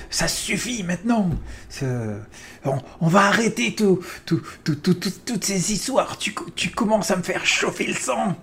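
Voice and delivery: high, strained outburst at nothing; angry shout at ceiling. Acted. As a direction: yell angrily at the ceiling. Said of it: "T'es en colère ou t'es terrifié".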